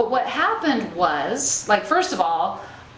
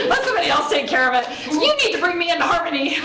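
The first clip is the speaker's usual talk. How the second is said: mock helpless panicker; 6 semitones higher, faster than usual. Poking fun at her old panicky self, fast whiny mock demand.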